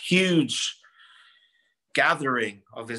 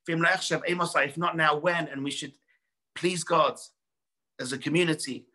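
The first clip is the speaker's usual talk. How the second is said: raised, fervent sermon voice; preaching; 6 semitones higher than usual. Acted. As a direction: drive the lesson home to the congregation. Preaching continues.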